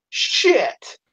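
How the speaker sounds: high, falling mock exclamation; retold curse. Man backing into a store display, mock exclaimed curse. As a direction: imitate his sudden curse on impact. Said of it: "he hits the thing and he goes".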